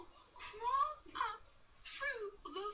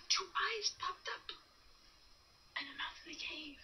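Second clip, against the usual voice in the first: plain reading voice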